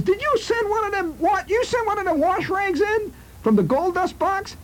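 high, squeaky mock father voice; scoffing father. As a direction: jeer at her in a silly high voice.

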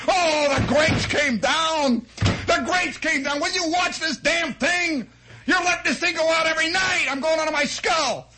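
high, loud exasperated shouting at the furnace; a father at the end of his rope, bellowing; father yelling. Retold or imitated. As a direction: blow your top over the fallen grates.

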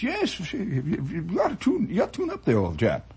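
mock upper-class Englishman urging to tune up; jolly English chap. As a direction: play a cheery British radio ham. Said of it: "He says".

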